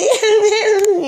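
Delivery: high, put-on ditzy giggle; dumb blonde giggle. Brushing off the man's grab, dumb blonde giggle. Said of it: "You know, dumb blonde".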